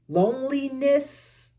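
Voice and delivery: Loneliness sung out high and slow, stretched; drawn-out high loneliness. Shown as a demonstration. Reader savoring the word Loneliness, high and slow. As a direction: lift Loneliness way up and draw it out. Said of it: "repeats word in new manner".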